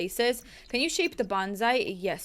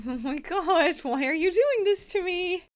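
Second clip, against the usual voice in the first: higher, slow, drawn-out mock wail at the game; mock despair; 8 semitones higher, slower than usual. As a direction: plead with the frozen game, hammed-up.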